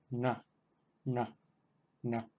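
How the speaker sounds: nasal "na" with the tongue tip curled back; retroflex na. Shown as a demonstration. Teacher giving the nasal retroflex for students. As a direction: hum a retroflex "na" through the nose. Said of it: "emitiendo aire por la nariz".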